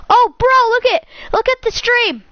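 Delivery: high, excited call; excited urging. Real, not acted. Gamer urging a friend to watch the stream, excited. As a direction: urge them to look, voice jumping up.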